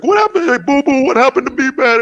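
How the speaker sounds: high, dismayed cartoon voice; hammed-up high alarm; Yogi Bear. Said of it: "someone shoots Yogi Bear and he's like".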